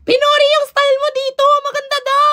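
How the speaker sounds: very high, excited call to her stylist; excited message. Retold or imitated. Messaging her stylist Mark that his style was praised, very high. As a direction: shriek the news with delight. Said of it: "Sabi ko".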